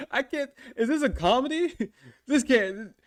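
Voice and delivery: high voice broken up by real laughter; cracking up while talking; laughing. Real, not acted. Laughing at the eggplant emoji.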